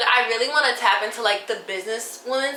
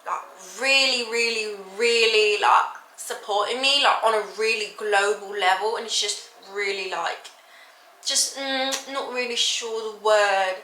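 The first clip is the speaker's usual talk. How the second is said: British accent. Shown as a demonstration. Nervous vlogger auditioning her accent for viewers, British accent. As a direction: keep up an amateur British accent, searching for words. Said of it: "I can speak in a British accent".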